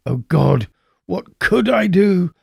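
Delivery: rising, frantic outcry; desperate cry to God; panicked cry. Acted. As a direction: cry it out as guilt overwhelms him. Narrator cries out in panic.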